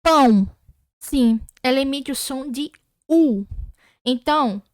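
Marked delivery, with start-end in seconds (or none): 0.0-0.5 Pão said louder, the ão ending in a clear U glide; emphatic teacher repetition of Pão; louder demo pronunciation. Shown as a demonstration. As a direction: say Pão again, louder, so they hear the U. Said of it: "Ouve bem o que eu falei".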